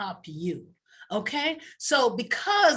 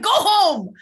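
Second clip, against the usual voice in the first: mock loud command; 10 semitones higher, 10 dB louder than usual. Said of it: "She was like".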